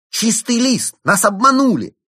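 very high, loud cries; alarmed shouting at the top of the voice; outraged cry. A narrator's reading. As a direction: shriek it in shocked indignation. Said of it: "вскрикнула она".

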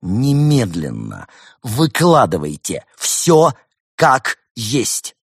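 slow, loud, emphatic order; loud ultimatum, each word weighted; stern demand. A narrator's reading. Arthur demands the whole truth.

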